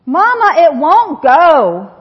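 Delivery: put-on loud, slow frustrated toddler cry; toddler yelling. Son yelling at his broken toy lawnmower, loud and slow. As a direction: bellow it like a stuck two-year-old. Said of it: "he yelled at the top of his lungs".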